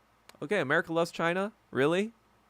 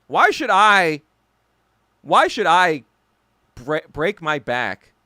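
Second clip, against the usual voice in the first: indignant; 8 dB louder, slower than usual. Real, not acted. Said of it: "I'm just kinda like".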